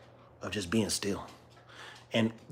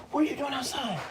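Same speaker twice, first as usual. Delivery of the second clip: pet talk; 13 semitones higher, 5 dB quieter than usual. Real, not acted. Repeated question to the dog.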